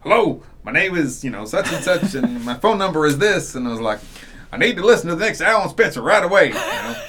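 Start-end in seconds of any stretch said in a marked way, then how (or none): 0.0-3.5 imitated Southern caller, raised pitch; loud Southern voicemail greeting; Southern accent. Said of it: "he's got this great Southern accent". 4.5-6.5 excited Southern fan, loud and high; quick, insistent Southern caller impression; Southern accent. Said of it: "he's got this great Southern accent".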